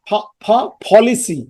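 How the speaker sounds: two puffed "pha"s, then "policy"; P drilled alone, then in "policy"; aspirated P in "policy". Shown as a demonstration. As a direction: puff the P into "policy". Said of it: "বাতাস বের হচ্ছে".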